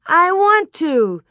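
put-on high, loud, drawn-out toddler whine; insistent child's demand. A narrator's reading. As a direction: stretch it out slowly and loudly, demanding. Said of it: "she repeated two or three times".

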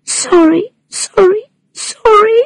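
put-on high rising child pleading; tearful child. A narrator's reading. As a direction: repeat it like a crying little girl. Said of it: "saying over and over".

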